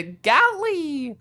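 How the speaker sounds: squeaky character call. Pretend. Calls character, voiced high.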